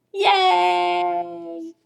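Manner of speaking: long, high-pitched yay; happy cheer. Acted. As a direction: cheer the cure with a drawn-out cry. Cheering that hiccups are gone.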